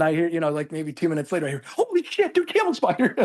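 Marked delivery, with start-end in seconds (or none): 1.7-3.1 high, startled outburst; a buddy yelping at a camel spider; shocked exclamation. Retold or imitated. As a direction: blurt it out in sudden fright. Buddy spots spider later.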